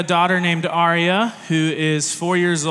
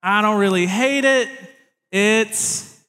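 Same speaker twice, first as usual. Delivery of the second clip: higher, shrugging indifference; neither love nor hate, half-hearted; lukewarm shrug; 5 semitones higher than usual. Retold or imitated. Churchgoer shrugs at a lunch suggestion, indifferent. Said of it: "And you're like".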